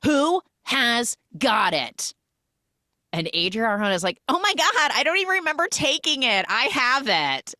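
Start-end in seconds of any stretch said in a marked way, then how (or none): 0.0-2.1 high groan, then slow spaced words; mock exasperated outburst. Alia slams the dinner table over her lighter, mock exasperation. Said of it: "slams her hands on the table". 4.3-7.5 high-pitched gushing oops; mock astonished confession. Adria finds she has the lighter, mock astonished. Acted surprise at having lighter.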